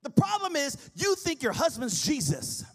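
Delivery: loud, sung-out sermon climax; chanted pulpit crescendo; preaching at full force. Acted. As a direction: preach over the music.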